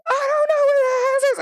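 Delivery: high, loud, panicked cry about the hazards; panicked scream. Retold or imitated. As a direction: shriek it in a panic. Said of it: "I'm on the phone screaming".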